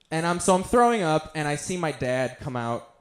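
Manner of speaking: ordinary talk, nothing marked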